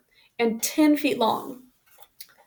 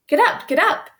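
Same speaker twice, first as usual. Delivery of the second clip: higher, louder, urgent shout; shouting at the oxen; 7 semitones higher, 5 dB louder than usual. A narrator's reading. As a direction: yell to make the steers pull. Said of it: "Almanzo kept shouting".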